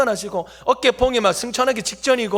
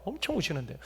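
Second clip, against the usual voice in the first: hushed repeat; 4 semitones lower, 14 dB quieter than usual. Shown as a demonstration.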